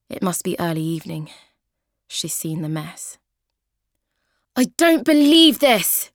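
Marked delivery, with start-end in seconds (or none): 4.5-6.1 loud, slow, much higher outraged yell; angry shout. Acted. Flatmate Kathy finding the mess, shouting in outrage. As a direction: explode at the wrecked flat. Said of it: "shouts Kathy".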